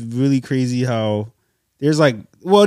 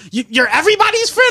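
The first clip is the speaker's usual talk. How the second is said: mocking high voice. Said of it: "You was like".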